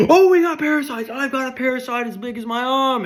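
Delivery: very high, loud silly cheer; gleeful dwarves. Pretend. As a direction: cheer about it like excited fools. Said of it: "they all start going".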